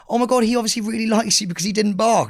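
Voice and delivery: oh-my-God owner impression; dog owner. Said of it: "and she goes".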